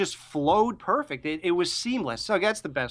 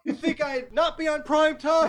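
high laughing put-on voice; mock villain voice; 10 semitones higher than usual. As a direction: tease it in a silly high voice. Mocking the one-liner.

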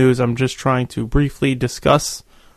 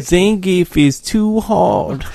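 whiny voice; 5 semitones higher, much slower than usual. Acted. Mock whiny gamer complaint.

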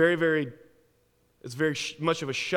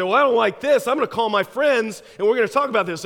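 higher and louder, stubbornly indignant; defiant protest; 6 semitones higher, 5 dB louder, faster than usual. Acted. American answers the secret police, louder defiant complaint. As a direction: push back at the police with raised voice.

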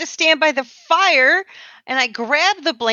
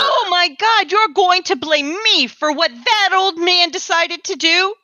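indignant, higher-pitched protest; 5 semitones higher than usual. Acted. Accused woman answers her accuser over the old man's will, indignant. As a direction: throw the blame straight back, pitched up.

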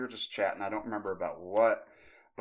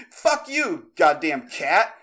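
high-pitched, loud cursing; hammed-up enraged scream; retold furious yell; 8 semitones higher, 10 dB louder, much slower than usual. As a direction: shout abuse after the cat. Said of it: "I was so pissed".

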